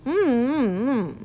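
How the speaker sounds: muffled mm through grass; mouth full. Acted. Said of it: "speaking with his mouth full".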